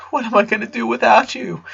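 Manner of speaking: put-on high, tearful boy's voice; a crying son clinging on; mourning. A narrator's reading. The son cries to his dad about losing his friend. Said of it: "Clyde was mourning too".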